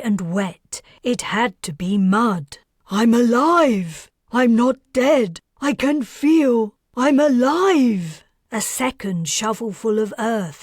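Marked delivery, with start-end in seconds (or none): 2.9-8.2 loud, high desperate shout; frantic cry. Acted. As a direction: yell it out in desperate relief. Buried woman realizes alive.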